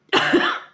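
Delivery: harsh real coughing; loud hacking cough; coughing fit. Real, not acted. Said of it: "j'arrête pas de tousser".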